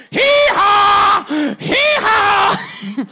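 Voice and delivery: loud, high laughing hee-haw; a big comic bray from a beaten donkey. Retold or imitated. Preacher braying as the donkey beaten again.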